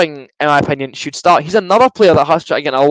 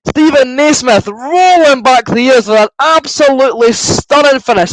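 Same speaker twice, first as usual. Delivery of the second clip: screaming far higher and louder, stretched slowly; ecstatic goal shout; 12 semitones higher, 6 dB louder, much slower than usual. Real, not acted. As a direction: roar it like the winning goal just went in. Goal celebration commentary.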